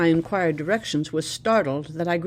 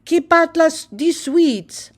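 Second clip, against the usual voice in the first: put-on high, slow child's begging call; children calling out; 11 semitones higher, 8 dB louder, slower than usual. A narrator's reading. Children crowd the visitor begging for sweets, high calling voice. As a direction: sing it up like a kid asking for sweets. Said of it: "calling out the greeting".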